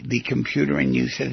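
ordinary talk

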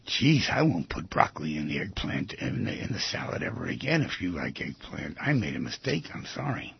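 soft, low, earnest apology; hushed, sincere admission of fault. Acted. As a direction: apologize quietly, as if you truly mean it. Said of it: "with all the sincerity in the world".

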